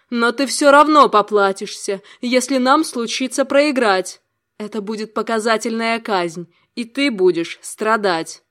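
loud, furious threat; angry threat. Acted. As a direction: promise his suffering loudly. Avalon threatens execution. (гневная угроза)

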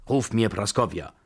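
commanding. A narrator's reading. Grandmother ordering her maid, commanding. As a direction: summon someone with authority. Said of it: "befahl die Babuschka".

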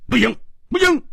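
spring up and refuse at once; jumping up protesting. Acted. Hu Tiehua, springing up, refuses the princess match in alarm. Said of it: "胡铁花却忽然跳起来说".

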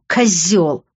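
woman's seething one-word insult; contained anger; angry hiss. A narrator's reading. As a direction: throw the insult at him. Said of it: "прошипела она".

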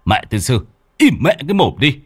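exasperated, high-pitched yelling; acted husband snapping under pressure; angry scolding shout. A narrator's reading. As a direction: bawl at your wailing wife to shut up. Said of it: "Ông Tùy quát".

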